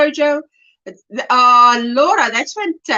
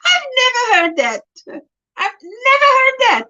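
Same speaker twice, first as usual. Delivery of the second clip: high, marvelling voice; amused surprise; 11 semitones higher than usual. Real, not acted. Delighted by a viewer's new phrase, high and marvelling.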